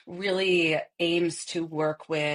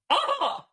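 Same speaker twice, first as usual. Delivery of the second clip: a sudden, much higher squawk of outrage; indignant peacock cry. Shown as a demonstration. Voice coach demonstrates the peacock warm-up, much higher indignant squawk. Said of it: "give us a sample of what an indignant peacock would sound like".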